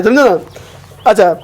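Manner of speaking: ordinary talk, nothing marked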